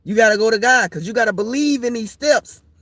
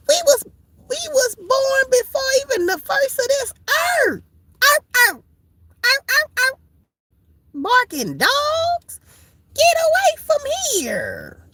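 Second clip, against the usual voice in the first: way up, half crying; high wailing rant. Acted. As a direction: wail it at the barking dogs.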